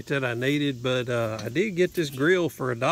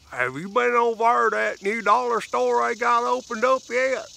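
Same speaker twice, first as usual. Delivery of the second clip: imitating a thick country southern accent, higher and louder; heavy southern accent; 8 semitones higher, 5 dB louder than usual. Teen girl finally asking the vlogger a question, in a broad southern accent. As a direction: play the staring teenage girl in a broad rural southern accent. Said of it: "one of the best ones I had ever heard".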